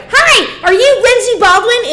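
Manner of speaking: high, bright mock-cheery greeting; mocking a perky saleswoman; chirpy salesgirl. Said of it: "some girl's like".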